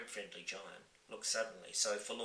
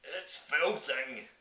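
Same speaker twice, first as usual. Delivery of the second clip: loud, slow spluttered through food; spluttering mouth full; 6 semitones higher, 7 dB louder, much slower than usual. Acted. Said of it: "he spluttered".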